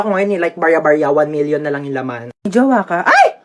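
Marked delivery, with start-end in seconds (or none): 3.0-3.4 sudden high yelp; sharp shocked cry; startled shriek. Acted. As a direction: jump at a jolt. Startled in skit.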